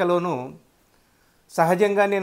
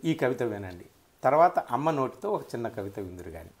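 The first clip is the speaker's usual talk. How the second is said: ordinary talk, nothing marked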